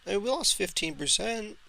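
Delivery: ordinary talk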